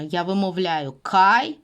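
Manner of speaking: "кай" pronounced with a clear ai glide; clear diphthong kai. Shown as a demonstration. Teacher tells students to listen to the diphthong. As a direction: open up the ai in "кай". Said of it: "Слухайте уважно".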